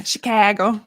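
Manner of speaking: imitated Chicago accent, flattened A. Woman mimics her sister from Chicago, Chicago accent. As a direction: say Chicago with the local short A. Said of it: "I do that with my sister".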